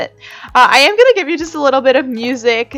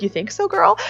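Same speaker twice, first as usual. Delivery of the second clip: sassy self-reply; 10 semitones higher than usual. Pretend. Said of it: "it's like".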